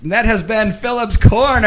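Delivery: high, loud show sign-off; announcer voice. Acted. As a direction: close the segment like a host. Announcing segment end.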